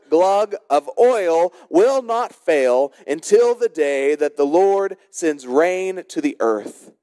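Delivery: normal talking voice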